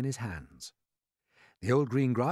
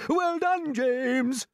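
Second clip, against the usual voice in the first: excited praise; 13 semitones higher, 7 dB louder, much slower than usual. A narrator's reading. Grasshopper cheering James.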